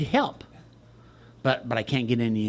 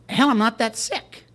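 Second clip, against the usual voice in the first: mock indignant; 7 semitones higher, slower than usual. Retelling a phone call, exasperated.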